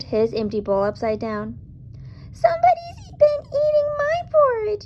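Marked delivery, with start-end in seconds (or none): 2.3-4.8 very high squeaky little bear; high squeaky cub. A narrator's reading. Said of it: "he squeaked".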